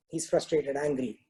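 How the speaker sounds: ordinary talk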